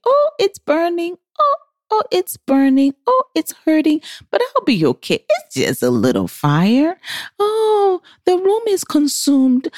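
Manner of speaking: high, singsong denial that ignores the flames; a woman kidding herself while the fire burns; mock self-talk. Single woman mocking her own denial, high and singsong. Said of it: "We just tell ourselves".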